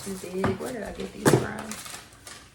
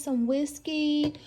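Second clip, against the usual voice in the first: ordinary talk, nothing marked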